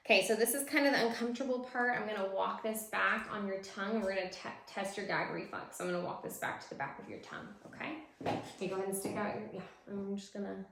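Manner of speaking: ordinary talk, nothing marked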